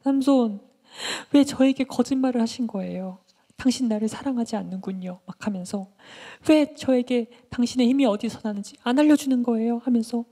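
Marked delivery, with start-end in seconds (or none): 0.0-5.2 high, plaintive accusation; acted resentful pleading, pitched up; reproachful. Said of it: "원망하면서". 6.5-9.8 high, loud, aggrieved questioning; acted resentful complaint; reproachful. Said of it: "막 하면서 삼손을 막 원망했어요".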